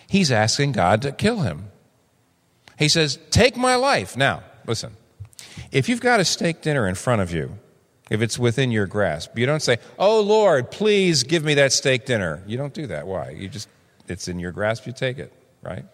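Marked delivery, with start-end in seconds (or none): 3.3-4.2 much higher, pleading cry; desperate plea. Acted. Preacher voicing Job asking God to take his life, desperate high plea. 10.0-12.4 mock pious prayer. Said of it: "It'd be stupid to say".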